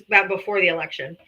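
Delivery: ordinary delivery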